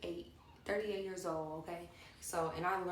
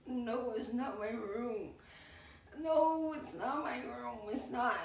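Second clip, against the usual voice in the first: higher, drawn-out protest; mock whiny denial; 5 semitones higher, slower than usual. Drunk host denying it is her room, mock whiny protest. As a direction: whine it out slowly in hammed-up denial.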